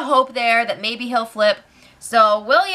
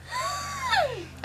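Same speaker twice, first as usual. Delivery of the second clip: high sudden gasp of disbelief; sharp shocked gasp; 10 dB quieter than usual. Real, not acted. Host reacts to the block plan with a shocked gasp. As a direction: gasp as if scandalized.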